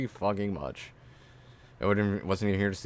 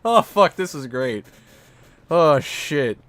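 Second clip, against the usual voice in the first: laughing; 7 semitones higher, 8 dB louder, slower than usual. Real, not acted. Laughing fit aftermath.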